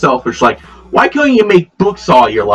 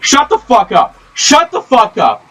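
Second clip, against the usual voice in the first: angry yelling; 9 semitones higher than usual. Real, not acted. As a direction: snap at them to shut up. Yelling at mom off-camera.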